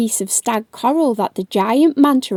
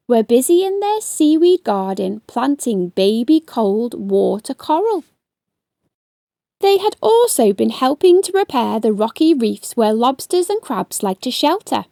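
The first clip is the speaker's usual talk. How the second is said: ordinary delivery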